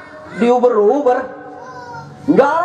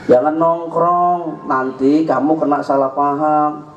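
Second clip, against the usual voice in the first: slow, low imitation of a parent; parent's gentle warning; 10 semitones lower, slower than usual. Mother warning her child against hanging out, imitated parental warning. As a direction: caution the child slowly, pitched well down. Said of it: "Udah diomongin".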